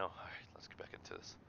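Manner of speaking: whispered aside; a hushed whisper to yourself. Real, not acted. Streamer whispering to get back on track, hushed.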